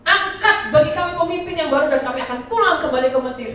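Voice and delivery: loud, high, demanding cry at Moses; quarrelling complaint. Retold or imitated. Israelites quarrelling with Moses, demanding a new leader, loud. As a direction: shout the people's demand like a rebellious crowd. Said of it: "Maka bertengkarlah mereka dengan Musa".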